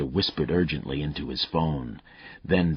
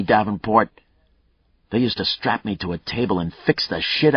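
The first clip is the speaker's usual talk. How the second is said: a hint of a growl, louder and higher; 6 semitones higher, 7 dB louder than usual. Killer growling at the cop about being fixed. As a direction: snarl back at being fixed. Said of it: "Mayle's voice turned to a growl".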